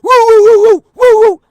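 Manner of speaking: high, loud woo-woo barking; mock dog barking at the commotion; dog bark imitation. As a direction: howl like the dog raising the alarm. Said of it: "So I hear he".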